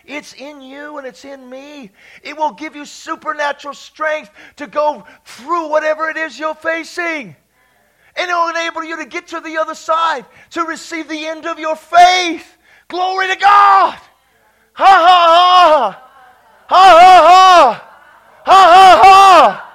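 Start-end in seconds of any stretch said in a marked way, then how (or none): 2.2-14.0 loud preacher building to a shout; preaching at full force. Real, not acted. Preacher promising strength by faith, full-force preaching. 14.7-19.7 high, shouted ha-ha-ha; deliberate loud laughter. Shown as a demonstration. Preacher leading laughter at the devil, loud deliberate laughs. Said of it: "practice some laughing".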